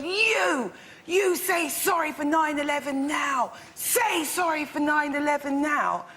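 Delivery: jab each word like an accusation; retold angry yelling. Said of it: "she points in my friend's face and she goes".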